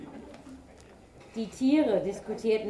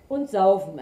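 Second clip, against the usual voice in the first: loud ox; 9 dB louder than usual. A narrator's reading. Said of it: "ergänzte der Ochse".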